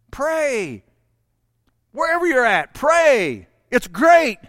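loud, ringing pulpit voice; fiery preacher in full flow; preaching at full force. Acted. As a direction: proclaim it over the congregation. Preacher exhorting.

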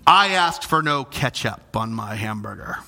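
a hint of a demanding Karen. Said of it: "they call it being a Karen".

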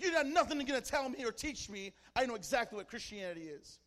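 imitating a loud, defiant scoffer; defiant retort. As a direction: start high and shouted, ease off toward the end. Retold scoffer refusing to be taught.

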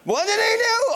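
hammed-up parental outrage; mock outrage; outraged parent. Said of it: "your parents are like".

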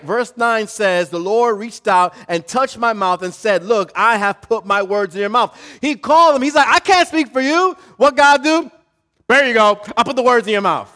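loud and high, driving the verse home; fiery sermon delivery; full-force preaching. Acted. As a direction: preach it big over the music.

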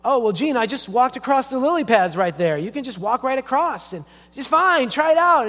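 high, loud, mock-innocent persuasion; crafty coaxing. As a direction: coax a little sister into a trick. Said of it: "being the crafty guy he was".

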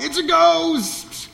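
slow, high mock shriek of fright; terrified disciples yelling at a figure on the water; scared yell. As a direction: cry out like you've seen a ghost. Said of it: "they yell out".

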